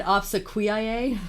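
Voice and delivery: "obsequiae" as ob-suh-kwee-eye-ay; alternate pronunciation. Shown as a demonstration. Co-host recalls a reading of the band name, ob-suh-kwee-eye-ay. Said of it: "I think I said".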